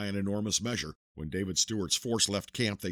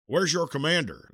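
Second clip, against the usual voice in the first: higher, louder officer's question; sharp demand; 8 semitones higher, 6 dB louder than usual. Acted. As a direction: bark the question at the young rider. Colonel demands after battle report.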